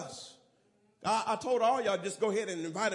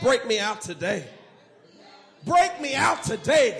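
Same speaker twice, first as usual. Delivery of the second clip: shouted refrain; 9 dB louder than usual. Real, not acted. Preacher shouting the refrain again. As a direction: belt the line out.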